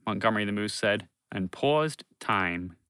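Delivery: usual speaking voice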